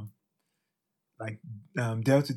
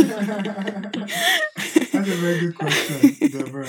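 laughing slowly; 8 semitones higher, 7 dB louder, slower than usual. Real, not acted. Slow laughing mock-formal reply.

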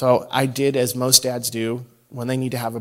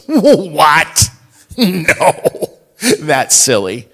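loud, higher disbelieving squeak; scoffing child; 5 semitones higher, 10 dB louder, much slower than usual. Retold or imitated. Dad imitating his daughter dismissing the idea, loud. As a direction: laugh it off as absurd.

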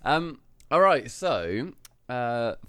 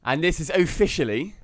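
put-on higher-pitched Norwich-accented announcing; 5 semitones higher than usual. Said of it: "Good accent. You're so Norwich".

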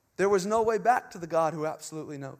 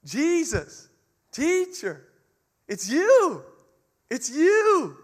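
high, loud cry of recognition; 6 semitones higher, 5 dB louder, much slower than usual. Acted. Mary crying out to Jesus at the tomb, loud and high. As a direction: call out in joyful disbelief, louder and higher. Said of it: "and cried out".

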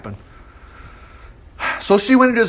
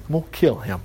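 low, softer vow of revenge; quiet threat; 4 semitones lower, 6 dB quieter, faster than usual. Acted. Absalom privately vows revenge for his sister, quietly menacing. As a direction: say it under your voice as a threat.

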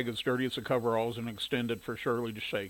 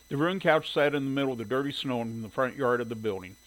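ordinary delivery, nothing marked

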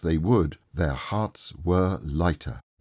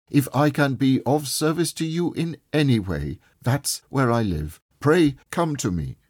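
higher-pitched, earnest offer of help; kindly nephew; 7 semitones higher than usual. A narrator's reading. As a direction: lift the voice in a sincere offer to help. Voices Scrooge's kindly nephew.

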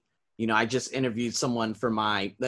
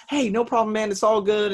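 breezy reply; 9 semitones higher, 4 dB louder than usual. Retold or imitated. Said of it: "I was like".

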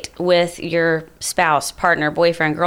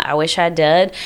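ordinary talk

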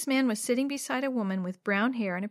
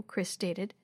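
usual speaking voice

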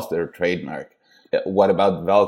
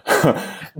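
quick amused laugh; short laugh. Real, not acted. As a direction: let out a brief laugh. Laughs at own joke.

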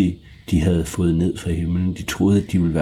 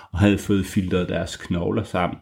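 plain reading voice

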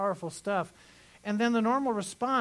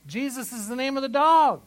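much higher and louder, landing the joke; loud punchline; 6 semitones higher, 10 dB louder than usual. Real, not acted. Preacher delivering the dog punchline to the church, loud and high. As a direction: belt the punchline out.

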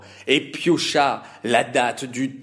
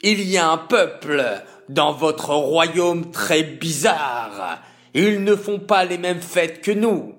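higher, louder sneering villain; nasty voice; 4 semitones higher, 4 dB louder than usual. Acted. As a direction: spit it out like a scheming villain. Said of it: "déclara Aman d'une mauvaise voix".

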